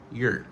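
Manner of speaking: "your" weakened to yer; short, casual "your" as yer; reduced form. Shown as a demonstration. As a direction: shrink the vowel of "your". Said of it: "Réduction de your, c'est your".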